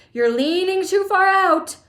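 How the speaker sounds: high, loud warning shout. A narrator's reading. Father warning son at river's edge, loud shout. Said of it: "shouted Mister Gloop".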